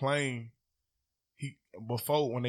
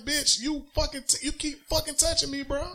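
mock yell; 3 dB louder than usual. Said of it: "I'm like".

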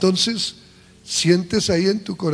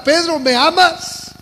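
louder, higher, slow insistent question; pressing Jesus; 9 semitones higher, 8 dB louder, slower than usual. Acted. Jesus asking Peter the third time, louder and insistent.

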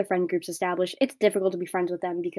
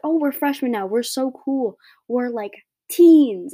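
higher, sing-song mock of cocky freshmen, last words dragged out slow; mocking freshman voice; 4 semitones higher, 7 dB louder, slower than usual. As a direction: poke fun at kids who think they're cool. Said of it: "everyone's like".

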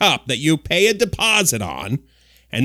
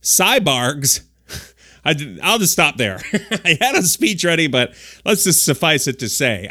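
ordinary talk

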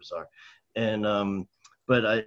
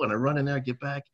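usual speaking voice

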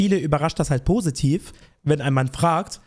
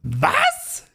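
shocked. Real, not acted. Shocked reaction to chicken number.

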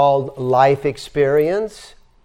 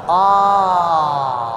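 open-throated ah, a bit louder; throat-opening ah with the head back; ah with head tilted back; 3 semitones higher, 4 dB louder than usual. Shown as a demonstration. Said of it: "and tilt your head back".

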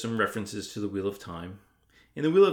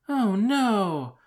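high, loud drawn-out dismay; dismayed Hermione; 12 semitones higher, 6 dB louder than usual. Acted. Hermione hearing her workshop is cancelled, dismayed. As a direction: wail it lightly, high and let down.

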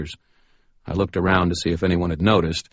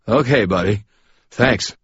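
lightly voiced drunk workman, a bit higher; a hint of a tipsy electrician brushing him off; drunk Chuck; 4 semitones higher, 5 dB louder than usual. A narrator's reading. Drunk Chuck thanks Harry.

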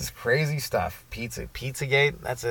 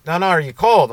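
loud, raised pitch, falling at the end; insistent question; 6 semitones higher, 7 dB louder than usual. Retold or imitated. Cop pressing a drunk walker, insistent question.

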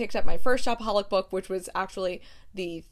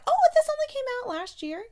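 much higher, startled question; surprised. Real, not acted. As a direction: sound genuinely caught off guard. Surprised by the release date.

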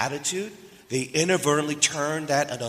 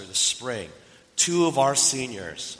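usual speaking voice

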